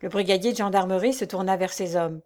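plain reading voice

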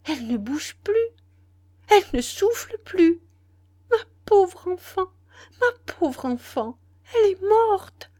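high, loud tearful cry of anguish; distraught servant. A narrator's reading. Servant finds her young mistress dead, anguished cry. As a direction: wail it out, voice pitched high. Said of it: "bégaya à voix très basse la servante".

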